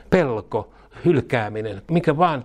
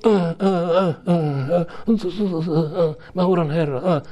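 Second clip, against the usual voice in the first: whiny higher groans, mocking a moaner; mock groaning; 7 semitones higher, slower than usual. Preacher mocking a believer moaning on the sofa, whiny groans. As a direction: groan pitifully, poking fun. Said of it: "ähkiä paikertaa sohvan nurkassa".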